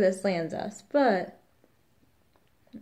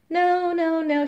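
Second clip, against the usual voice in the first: high, louder mock refusal; imitating midwife refusing; 11 semitones higher, 5 dB louder, slower than usual. Midwife turning down the food, in a high mock refusal. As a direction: politely wave off the offer, pitched up.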